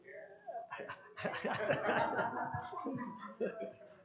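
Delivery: long high-pitched laugh; real, drawn-out amused laughter. Real, not acted. Speaker laughs at own story.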